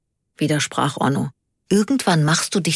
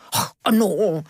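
drawn-out exasperated moan of a name; impatient groan; 3 semitones higher than usual. A narrator's reading. Karl to his slow driver Onno, impatient. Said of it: "Ungeduldig schlug Karl aufs Armaturenbrett".

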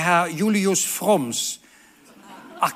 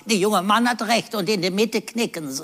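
put-on old man's quip; 4 semitones higher than usual. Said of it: "zu der Verkäufer sagt".

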